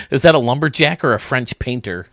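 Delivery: ordinary talk, nothing marked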